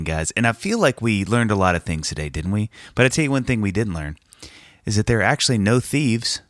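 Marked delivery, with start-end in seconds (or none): none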